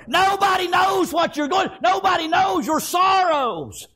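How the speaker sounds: high, ringing sermon cry; fervent preaching. Acted. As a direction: lift it high and preach with full force. Preaches at full force.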